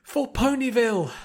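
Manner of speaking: high, loud shout; group battle cry. Acted. Friends shouting together as they raise the elements, loud.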